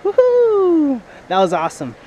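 usual speaking voice

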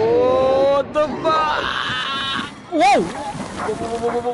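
slow, high, loud scared yell; real terror, drawn out; frightened shout. Real, not acted. Scared by game event.

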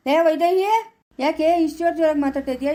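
loud, high call to his wife; calling out crossly. Acted. Husband coming home to his quarrelling wife, loud and cross. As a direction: raise the voice to check her shouting.

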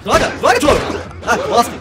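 loud angry curse; frustrated yell. Real, not acted. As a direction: yell it out at the game. Gamer yelling at game.

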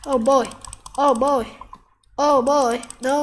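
usual speaking voice

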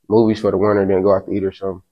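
ordinary talk, nothing marked

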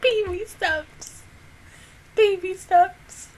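high, slow, tearful repeat. Real, not acted. Emotional reaction to anime scene.

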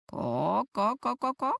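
lightly voiced hen's alarmed ko ko; mock hen cackle, up and down; hen clucking. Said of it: "zaskrzeczała kura".

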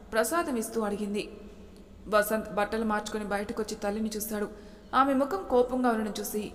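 plain reading voice

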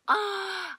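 high, drawn-out "ah" of suspense; mock agonized cry. Reader retelling reaction to an intense ending, playful agonized cry. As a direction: act out a reader's frustrated wail.

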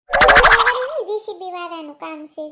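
loud, slow, pointed question; loud slow demand. Acted. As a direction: drag it out loudly, demanding. Women bickering in village comedy. (મોટેથી ધીમો સવાલ)

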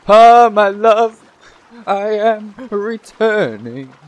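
very slow, high, loud operatic declaration; hammed-up romance. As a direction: stretch it out like a tragic stage lover. Melodramatic return to lover.

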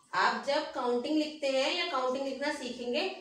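ordinary talk, nothing marked

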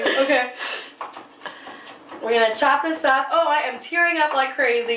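ordinary delivery

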